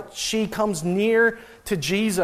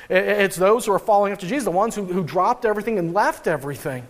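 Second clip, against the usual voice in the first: usual speaking voice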